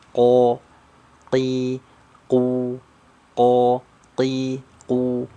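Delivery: ko ti ku said one syllable at a time from the back of the tongue; qof syllable drill. Shown as a demonstration. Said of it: "huruf kof itu keluarnya dari pangkal lidah". (latihan suku kata ko ti ku dari pangkal lidah)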